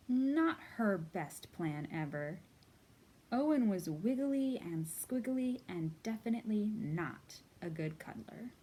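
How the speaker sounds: put-on lively read-aloud to children; storybook reading. A narrator's reading. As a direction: read it like story time for little kids. Reads a picture book aloud.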